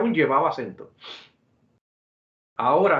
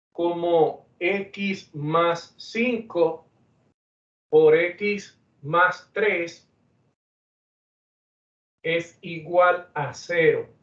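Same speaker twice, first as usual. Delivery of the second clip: slow dictation; much slower than usual. Shown as a demonstration. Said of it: "dictating an equation".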